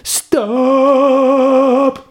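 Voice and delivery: high shouted stop; shouting stop. Acted. Girl yelling at the waffle van, high shout. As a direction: flag down the van with a high yell. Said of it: "Laura råbte til bilen".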